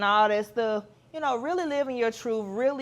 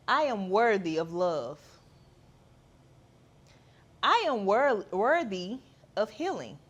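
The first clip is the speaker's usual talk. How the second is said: affirmation. Shown as a demonstration. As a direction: lead the listener in self-love affirmations. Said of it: "repeat these words after me".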